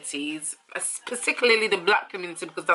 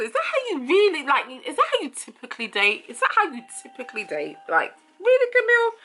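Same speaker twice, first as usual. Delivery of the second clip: lightly voiced incredulous host, higher; imitating the host; 8 semitones higher than usual. Host pressing Camille about how she dates, mock disbelief. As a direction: press her like a doubtful host. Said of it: "the host had to ask her".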